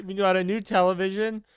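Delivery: normal talking voice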